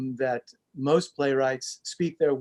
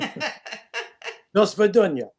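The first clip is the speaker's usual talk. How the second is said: very slow, higher, hammed-up Russian; bad Russian accent; 6 semitones higher, 4 dB louder, much slower than usual. Shown as a demonstration. Actor signing off his accent demo for the hosts, exaggerated Russian accent. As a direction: stretch it out like a stage Russian. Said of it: "bad Russian accent".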